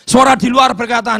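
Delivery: ordinary talk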